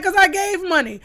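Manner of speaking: high, loud proud comeback; emphatic retort. Retold or imitated. Answering people who doubted her fundraising, loud and high. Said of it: "I'm like".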